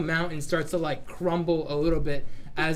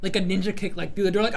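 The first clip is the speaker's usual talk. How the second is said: ordinary delivery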